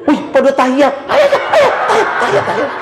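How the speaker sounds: high, excited urging to hurry up. Retold or imitated. Woman hurrying her friend to catch prayer, high and excited. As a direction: rush her along, squealing with excitement.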